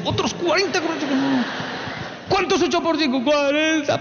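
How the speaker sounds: put-on high, timid child's voice; scared child. Niño asustado contestando la tabla, high timid child voice. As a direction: whimper the answer like a beaten kid.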